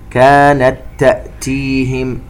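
slow chanted tajweed recitation with echoing qāf; Quran recitation. Shown as a demonstration. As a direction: recite slowly, bouncing the qāf. (تلاوة مرتلة ببطء مع قلقلة القاف)

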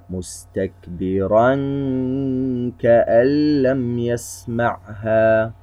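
slow recitation, the hidden noon of كأن hummed through the nose; ikhfa ghunnah. Shown as a demonstration. A teacher recites an example of ikhfa. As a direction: hum the noon in كأن lightly for two counts. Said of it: "نرى مثال على الإخفاء".

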